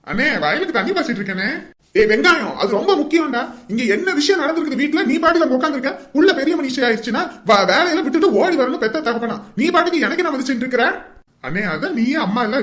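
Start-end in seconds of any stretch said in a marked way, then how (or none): 1.9-10.8 high-pitched, heated telling-off; exasperated elder brother laying into him; angry scolding. Acted. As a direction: scold a careless younger brother. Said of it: "அண்ணன் இப்படி கோவப்படுறாரு".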